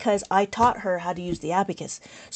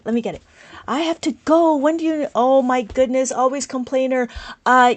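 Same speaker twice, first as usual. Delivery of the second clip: usual speaking voice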